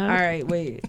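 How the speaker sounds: after laughing hard. Real, not acted. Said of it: "Let me collect myself".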